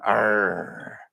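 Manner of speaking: short pirate arr; pirate growl. Pretend. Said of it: "you better be able to talk like a pirate".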